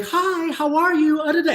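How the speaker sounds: high, sugary and trying too hard; nervous people-pleaser gushing hello, pitched high; overly nice greeting. Retold or imitated. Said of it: "you're trying too hard".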